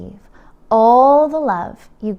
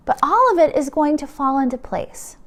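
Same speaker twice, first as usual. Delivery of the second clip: ordinary delivery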